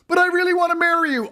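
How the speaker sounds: high, quick, whiny woman's plea; mocking pleading woman. Mocking a woman begging him to marry her, high and quick. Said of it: "mock woman pleading".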